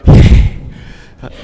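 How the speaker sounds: breathy, voiceless mock cheer; children's assembly cheer. Imitating kids coming into assembly, breathy mock cheer. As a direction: imitate a crowd of kids going "yeah". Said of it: "They come into the assembly and they go".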